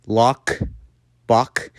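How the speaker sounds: "lock" and "Bach" ending in a plain K; hard K ending. Shown as a demonstration. Teacher showing how Americans say it, K in "Bach". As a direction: close "Bach" on a hard English K. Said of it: "as the K sound".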